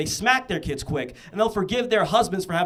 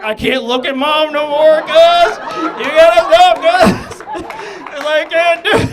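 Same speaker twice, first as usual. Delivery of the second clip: high, loud pained wail through laughter; anguished laughing cry; 8 semitones higher, 11 dB louder, slower than usual. Retold or imitated. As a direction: beg him to stop, half laughing. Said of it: "Homie right here is like".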